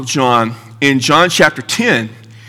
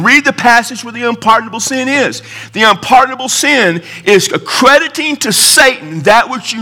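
impassioned preaching, pitched up; żarliwe kazanie; 6 semitones higher than usual. Real, not acted. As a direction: press the argument on the congregation. Preacher rebuts an objection.

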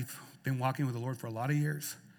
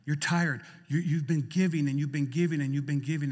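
usual speaking voice

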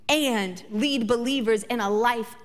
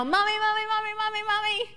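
put-on high sing-song kid calling Mommy; child tattling; 10 semitones higher, faster than usual. Little son running to tattle on brother, high sing-song. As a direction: call out like an eager little boy. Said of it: "to let me know".

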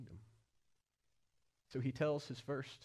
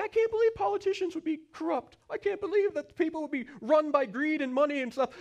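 mock disbelief; 11 dB louder than usual. Mock naive quote of former self.

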